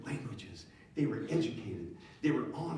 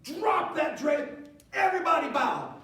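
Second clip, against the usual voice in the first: king commanding; 12 semitones higher, 6 dB louder than usual. Retold or imitated. King to crowd and crew, shouting the cue. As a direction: shout the cue to drop the drape. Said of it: "he says".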